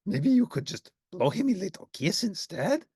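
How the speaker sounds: high, hopeful monster voice; hopeful Grover. Acted. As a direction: ask hopefully. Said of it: "asked Grover hopefully".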